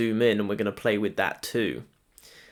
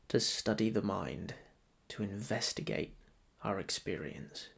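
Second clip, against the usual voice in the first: softer, measured meditation voice with long pauses; guided meditation; 7 dB quieter than usual. Acted. Meditation guide to listeners settling into breath practice, soft guided meditation. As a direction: guide them gently into noticing the breath.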